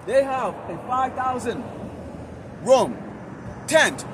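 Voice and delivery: loud, hard-driving sermon with punched words; forceful street preaching. Real, not acted. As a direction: berate the crowd like a street preacher. Street preaching, scolding listeners.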